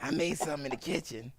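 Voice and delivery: soft monster voice doing domestic talk; monster-like woman. Retold or imitated. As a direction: mimic her wrecked voice at breakfast. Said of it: "imagine that's how she sounds every day".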